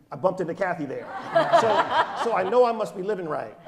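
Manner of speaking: laughing. Real, not acted.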